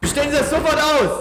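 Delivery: shouted high official voice, distorting the mic; overdriving mic. Acted. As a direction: bark it as a raid officer until it clips. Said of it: "Du übersteuerst grad auf jeden Fall".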